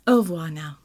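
put-on French-accented farewell; light French-accented sign-off. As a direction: say goodbye coquettishly with a French accent. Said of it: "seductive housewife French accent".

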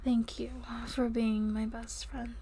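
tender soft whisper. Acted. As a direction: hush it lovingly.

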